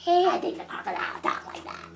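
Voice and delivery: fast, breathy, whispered creature voice; playful whispered crocodile. Pretend. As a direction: hiss it like a crocodile. Voicing the crocodile.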